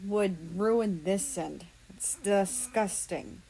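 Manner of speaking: slow, disgusted sneer; disgusted character voice. Acted. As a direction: drawl it out in disgust.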